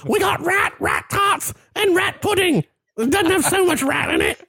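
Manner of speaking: put-on very high English old-woman falsetto; comic biddy reciting a menu; Monty Python old woman. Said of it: "like the old woman from Monty Python".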